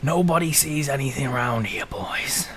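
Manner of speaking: put-on whispered old man's warning; evasive old shopkeeper. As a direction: hush it like a local keeping secrets.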